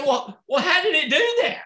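high, laughing amazement; imitating a stunned, laughing guest; amazed laughing. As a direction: laugh in disbelief at the result. Guy amazed at the screen.

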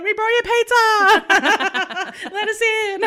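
loud, slow, laughing call through a window; mock friends calling. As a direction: call out loudly, half laughing, slowly.

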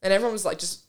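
ordinary talk

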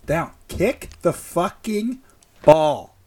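exasperated yelling at the team; frustrated shout. Real, not acted. Frustrated rant about football.

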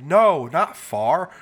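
loud, slow, insistent Southern correction; Southern accent. Retold or imitated. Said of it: "had a really thick Southern accent".

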